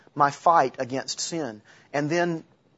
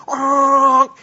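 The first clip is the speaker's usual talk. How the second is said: high, loud imitated driver's yell; angry driver's scream at a wrong-way car; mock angry scream; 10 semitones higher, 9 dB louder than usual. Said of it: "people are going".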